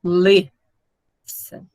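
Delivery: Listen split, first syllable strong, second dropping away; "Listen" in two beats, weight on the first; stress on first syllable. Shown as a demonstration. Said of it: "A mais forte é a primeira".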